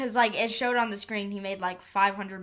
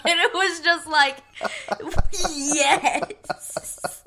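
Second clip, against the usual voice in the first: high, loud, breaking up with laughter; laughing; 7 semitones higher, 6 dB louder, much slower than usual. Real, not acted. Friend relishing the rich people's gory deaths, high and laughing. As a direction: crow it gleefully through laughter. Cracking up over gory scene.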